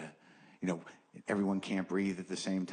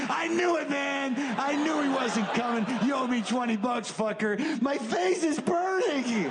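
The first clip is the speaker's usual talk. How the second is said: gleeful shout. Acted. Non-believer high-fiving the guy next to him, gleeful yell. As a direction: whoop in victory while burning.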